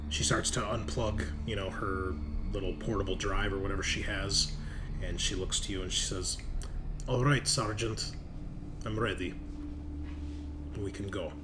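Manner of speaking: normal talking voice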